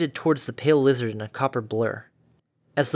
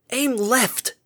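high shout of command; 10 semitones higher than usual. Acted. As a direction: snap the order out fast. Harmony directs Derpy, shouting.

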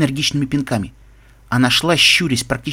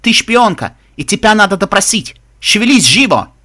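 loud, raised accusing shout; barked order; 7 semitones higher, 6 dB louder than usual. Acted. Guard hauling a spy off for questioning, loud barking. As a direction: hammer out the command to hurry. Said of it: "рявкнул охранник на ломаном эквестрийском".

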